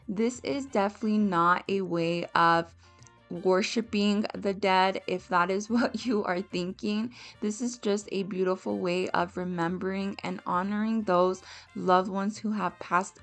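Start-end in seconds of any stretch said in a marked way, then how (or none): none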